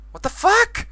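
high, loud astonished yells; shocked shouting. Real, not acted. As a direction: shout in wild disbelief. Sees flying burning skeletons.

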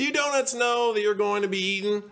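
high, sustained call across the shop; high, far-carrying yell. Acted. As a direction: holler a question to a crowd, way up high. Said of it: "baker yelled to the other donuts".

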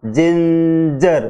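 slow जिंजर, g said as j; ginger with j sound. Shown as a demonstration. Teacher showing the class the j in जिंजर. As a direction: draw out जिंजर, j not g. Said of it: "ध्वनि इसके अंदर आपके ज की आएगी".

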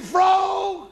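very high, loud, slow Southern shout. Acted. Alabama man sparing the frog after the sheriff's threat, high slow Southern shout.

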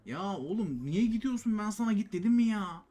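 loud, high-pitched telling-off; exasperated scolding. Real, not acted. Player scolding his character for moving, exasperated.

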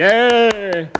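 long, high whoop; crowd cheer. A narrator's reading. Audience greeting Prop as he enters, loud high cheer. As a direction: cheer loudly, drawing it out. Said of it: "så klappede de og råbte".

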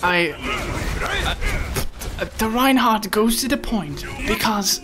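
light nature documentary narrator; hushed wildlife-film narration, lightly imitated; David Attenborough. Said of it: "if he was narrating this right now".

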